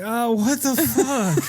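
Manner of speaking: high, drawn-out mock dismay; retold dismay. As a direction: wail it slowly in comic disbelief. Retold disgusted teenage reaction.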